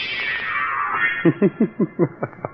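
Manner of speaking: breathy high evil chuckle; sinister laugh. Acted. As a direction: laugh like a ghoulish host. Horror host laugh.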